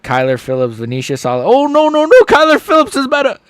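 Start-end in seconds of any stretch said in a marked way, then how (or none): 1.4-3.4 loud, very high burst of alarm; excited shouting. Real, not acted. Streamer watching his fighter get hit, excited shouting. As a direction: shout in disbelief at the action.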